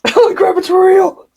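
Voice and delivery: squeaky, very high cartoon squeal; silly clown. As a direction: shriek it like a goofy clown. Goofy squeal echoing a scene.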